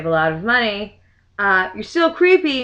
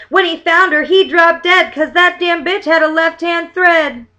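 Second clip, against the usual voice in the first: louder, higher bouncy verse reading; lively verse recital; limerick reading; 5 semitones higher, 5 dB louder than usual. Acted. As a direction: recite it like a party rhyme. Reads limerick aloud.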